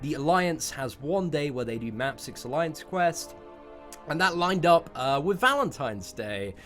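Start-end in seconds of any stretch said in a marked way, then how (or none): none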